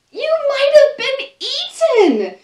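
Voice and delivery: high, loud shocked mother's cry; alarmed Mama. Acted. Mama hearing the monkeys' tale, shocked high cry. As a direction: gasp it out high as a horrified mom.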